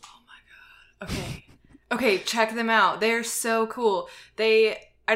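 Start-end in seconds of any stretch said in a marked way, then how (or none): 0.0-1.4 slow breathy whisper. Real, not acted. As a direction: gasp it under your breath. Embarrassed at not knowing group.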